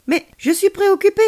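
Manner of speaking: put-on high, loud childish protest; whining insistence. A narrator's reading. Child insisting to alien friend about worry, exasperated. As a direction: wail it out stubbornly, pitched right up.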